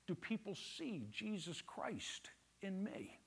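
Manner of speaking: slow, breathy whispered question; hushed slow whisper of a question. Real, not acted. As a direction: drop it to a hush and let it land. Preacher drops to a hush.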